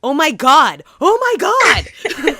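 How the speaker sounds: high, loud, laughing oh my God; starstruck shout. Retold or imitated. Realizing friend's dad voiced the Tick, shrieking. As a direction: shriek with fan delight. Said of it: "I was like".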